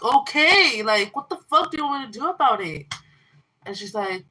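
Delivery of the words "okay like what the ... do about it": loud, high exasperated snap; exasperated shout at the sister; retold angry retort. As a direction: fire it back at her. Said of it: "I'm like".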